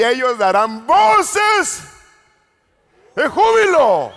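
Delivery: loud, slow, ringing proclamation; jubilant proclaiming. Acted. Preacher to the congregation, loud slow jubilant proclamation. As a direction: stretch each word out in triumph.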